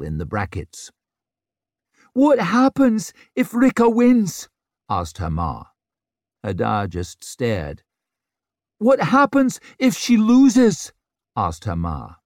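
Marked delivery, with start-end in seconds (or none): 2.1-4.5 high, loud, frantic question; panicked cry. A narrator's reading. Mother panics during the battle. 8.8-10.9 high, loud, slow frantic question; panicked cry. A narrator's reading. Mother panics during the battle.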